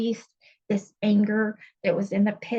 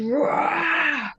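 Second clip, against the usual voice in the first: full-throated, higher roar; roar of release; 6 semitones higher than usual. Retold or imitated. Woman retelling her rage release at church, roaring. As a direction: let out a raw roar of rage. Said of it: "along the releasing of just".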